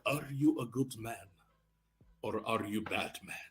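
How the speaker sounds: put-on quiet old Italian man asking. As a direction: ask it like a quiet village elder. Old Italian man questions him.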